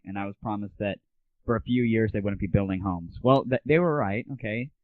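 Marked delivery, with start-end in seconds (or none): none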